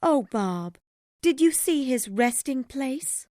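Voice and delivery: high-pitched wife asking about a grave; high, plaintive character voice; grieving wife. A narrator's reading.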